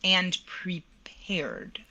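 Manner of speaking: slow whisper on "and prepared"; whispered punchline. Shown as a demonstration. Advising young singers, whispering the key word. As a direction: drop into a slow whisper like a secret.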